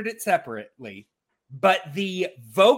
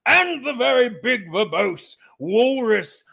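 lightly voiced big walrus, higher and louder; 6 semitones higher, 5 dB louder than usual. Shown as a demonstration. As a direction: puff it up like a verbose walrus. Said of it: "back and forth between that".

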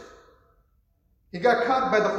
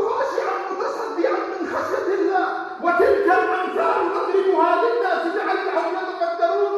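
fast, high chanted verses; تلاوة سريعة; rapid Quran recitation; 7 semitones higher, much faster than usual. Acted.

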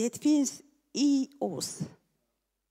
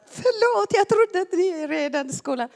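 startled apology; 7 semitones higher, 5 dB louder than usual. Retold or imitated. Acted apology after being startled.

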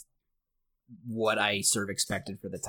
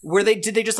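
ordinary delivery

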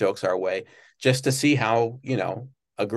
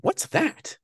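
much higher, eager question; curious what's that. Retold or imitated. Guest imitating Jay spotting a car, surprised. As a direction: perk up at something new.

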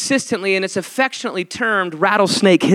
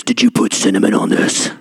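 deep, low mock wife voice; lowered imitation of the wife's question; 12 semitones lower than usual. Husband mimics his wife asking, in a deep mock voice. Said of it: "That's exactly how she sounds".

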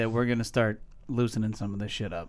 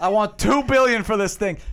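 loud demand; 7 semitones higher, 6 dB louder than usual. Pretend. Mock negotiation, asking price.

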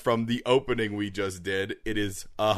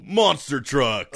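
hyped announcer; 5 semitones higher, 6 dB louder, slower than usual. Acted. Hyped monster truck ad.

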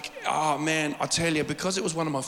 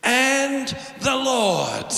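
much higher, louder, drawn out very slowly; mock grand preacher; 9 semitones higher, 6 dB louder, much slower than usual. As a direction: stretch it out like a preacher straining for the crowd. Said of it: "I'm sort of like".

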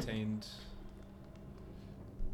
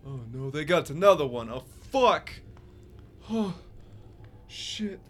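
raised, pained cry sinking into a sigh; dismayed old man; 5 semitones higher, 7 dB louder than usual. Acted. Old prisoner reacts to another victim, dismayed. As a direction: wail as another one gets taken. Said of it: "you hear the old man go".

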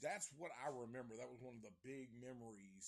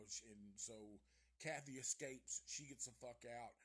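ordinary talk

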